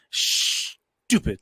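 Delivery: long hissing "shh" before "Stupid"; "Stupid" opened with a long sh; drawn-out S. Retold or imitated. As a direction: stretch the first sound of "Stupid", then drop the rest. Listener imitating drawn-out "stupid.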